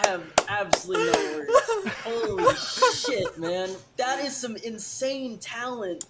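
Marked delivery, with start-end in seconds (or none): 0.5-3.4 high, slow, laughing holy shit; amazed exclamation. Real, not acted. Stranger stunned after hearing the freestyle, laughing amazement.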